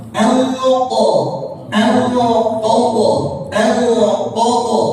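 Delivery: "Anqadha" drawn out, nasal n; drilling the word; repeated recitation. Shown as a demonstration. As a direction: hold it slow and nasal. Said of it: "Jangan dibaca anqadha".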